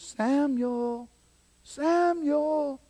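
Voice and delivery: high, long-drawn night call. Acted. God calls the sleeping boy Samuel, drawn-out high calling.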